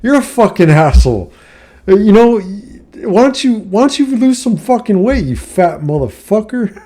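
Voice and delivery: jab at him, half laughing at your own line; retold insult yell. Retelling the insult hurled at the rude golfer, high heated yell. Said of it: "I said".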